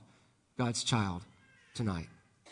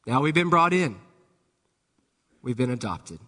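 ordinary delivery

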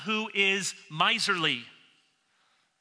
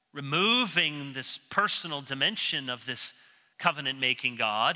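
ordinary delivery

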